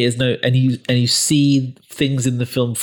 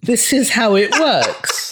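slow, higher, solemn pronouncement; mock doom voice; 10 semitones higher, much slower than usual. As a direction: intone it like a grim prophet. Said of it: "I was watching the trailer thinking".

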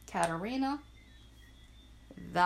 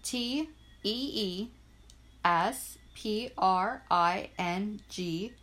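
spelled out slowly; slower than usual. Shown as a demonstration.

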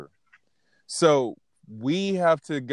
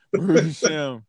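laughing; 2 semitones higher than usual. Real, not acted. Laughing after emotional moment.

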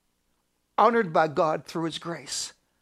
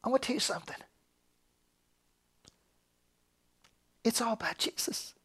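soft, intimate aside to congregation; quiet, reverent hush; 9 dB quieter, faster than usual. Real, not acted. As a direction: drop right down and confide it. Hushed turn after loud preaching.

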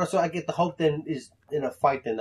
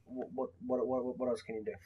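softer, stammering challenge to an archer; mock demand; 11 dB quieter, faster than usual. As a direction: press him for another power. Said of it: "I'm like".

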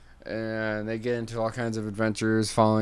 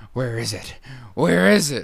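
frantic mole; 5 semitones higher, 9 dB louder than usual. Acted. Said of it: "he's like".

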